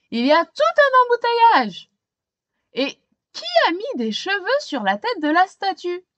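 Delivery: high, loud indignant chief; police chief's voice. Acted. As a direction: demand answers over the traffic jam.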